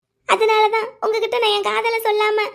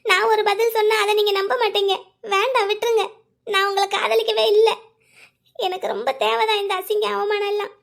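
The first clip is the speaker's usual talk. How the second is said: usual speaking voice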